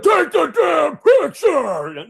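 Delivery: high, loud, drawn-out yell; re-enacted scream at a dawdling kid; furious yelling. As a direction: bellow it in high-pitched frustration. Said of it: "she thinks I'm yelling at her".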